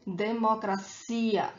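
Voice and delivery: slow democracia with a long CI; stress on final ia. Shown as a demonstration. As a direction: repeat democracia slowly, stressing the ia. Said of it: "is that ia at the end".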